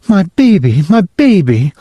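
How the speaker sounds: a hint of a doting mother; mother's voice. Mother greeting her returned son, lightly voiced and fond.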